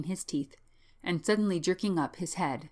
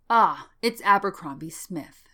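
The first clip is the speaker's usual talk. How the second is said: slow, louder greeting; drawn-out recognition; 2 semitones higher, 7 dB louder than usual. Acted. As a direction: greet the visitor loudly, stretching the words. Bellingham recognizes his visitor.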